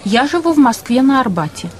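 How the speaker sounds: usual speaking voice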